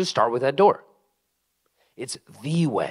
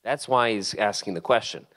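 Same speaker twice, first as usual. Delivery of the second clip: ordinary talk, nothing marked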